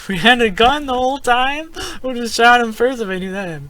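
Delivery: very high, loud disbelief; squeaky-high indignant exclamation; incredulous outburst. Real, not acted. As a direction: shout it in amused outrage. Just learns he had a gun.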